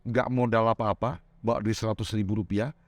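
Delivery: ordinary talk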